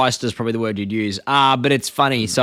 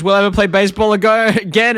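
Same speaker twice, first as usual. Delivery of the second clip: lightly voiced cartoon boy, higher; Bart; 7 semitones higher, 4 dB louder than usual. Boy asks dad about playing baseball, lightly mock-worried. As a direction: imitate a kid asking pitifully. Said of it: "And then Bart goes".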